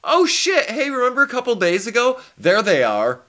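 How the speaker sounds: high, quick excited mock soldier; a hammed-up soldier's gleeful recognition; soldiers recognizing them. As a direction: spot old enemies with glee. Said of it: "they're gonna go".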